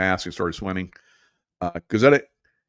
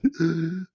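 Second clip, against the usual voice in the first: sharp gasp; 7 semitones higher than usual. Retold or imitated. Struggling to breathe while swollen.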